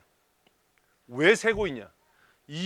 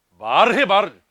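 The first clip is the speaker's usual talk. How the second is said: shouted urging, much louder; loud demand; 3 semitones higher, 12 dB louder than usual. Real, not acted. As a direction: bark at them to answer. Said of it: "demanding an answer loudly".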